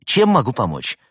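upbeat and loud, eager to serve; cheerful young technician. A narrator's reading. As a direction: offer help like an eager young support worker. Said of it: "молодой и жизнерадостный".

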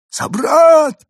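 high loud call out; alien call. A narrator's reading. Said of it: "позвал Евгений". (громкий высокий зов пришельца)

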